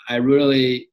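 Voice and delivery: ordinary talk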